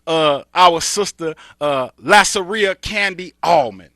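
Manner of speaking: loud, high, energetic host intro; big announcer welcome; hyped show host. Acted. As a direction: fire up the audience like a radio host.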